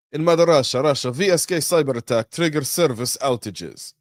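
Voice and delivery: mock Russian accent. Podcast host jokingly opening Russia news in mock Russian accent. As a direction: play a cartoon Russian newsreader. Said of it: "I'm not gonna do it in a Russian accent".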